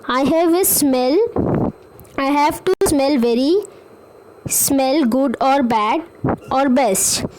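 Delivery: put-on slow, sing-song reading of a children's poem; slow rhyme reading. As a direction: read each line slowly for young learners. Teacher reads rhyme to kids. (बच्चों को धीरे-धीरे कविता पढ़कर सुनाना)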